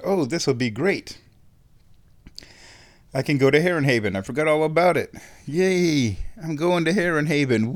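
rising giddy excitement; bubbling over with glee; excited. Retold or imitated. Excited plan to go out.

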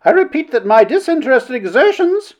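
very high, haughty insistence; affronted orator, pitched right up; insistent Mrs. Blank. A narrator's reading. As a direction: press on over the hecklers. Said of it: "and so she continued".

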